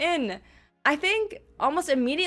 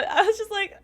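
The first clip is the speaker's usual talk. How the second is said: ordinary talk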